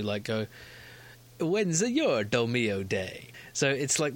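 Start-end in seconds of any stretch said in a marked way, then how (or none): 1.4-3.3 slow, drawn-out Italian puppet voice; mock Italian accent. Host imitating pasta sauce advert puppets, mock Italian accent. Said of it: "the same kind of voice".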